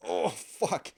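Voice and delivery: loud, high "oh no" reaction; re-enacted burst of sudden panic; retold alarmed outburst. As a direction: blurt it out in mock alarm. Blurts alarm in retold story.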